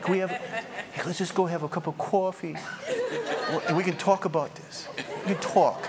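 high, meek mock negotiator; mock timid appeasing. Timid believer inviting a demon for coffee, mock appeasing. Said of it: "You're like".

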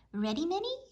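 high cheerful call. A narrator's reading. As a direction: call to her from the walk. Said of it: "he called".

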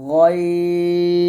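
"গইন" full and heavy from the throat, held very long; thick ghayn. Shown as a demonstration. Teacher modelling the thick throat letter, thick ghayn. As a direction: sustain a thick "গইন" on a steady note. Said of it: "দুইটাই মোটা করে উচ্চারণ করতে হবে".

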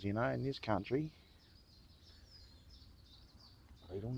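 hammed-up Aussie accent rising on the "you know"s. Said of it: "I've just come out with an Aussie accent".

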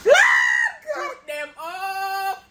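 loud stretched-out yell; shouting. Real, not acted. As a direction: yell it, drawn out. Filming feet, yelling to raise them.

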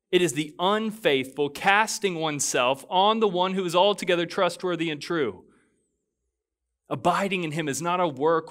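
preaching at full force. Acted. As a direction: carry the room with fervour.

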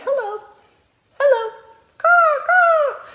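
very high, loud bird greeting; be the cheerful bird saying hello; happy bird voice. Acted. Bird in picture book greets reader, very high loud.

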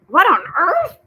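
high, loud, baffled outburst; sputtering surprise. Acted. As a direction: splutter it out in disbelief. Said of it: "Mister Easton sputtering".

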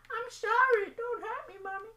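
very high, loud mock-terrified kid voice; squeaky scared child. To Mommy, begging not to be hurt in a squeaky little voice. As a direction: beg like a tiny child caught out.

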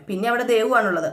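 ordinary delivery

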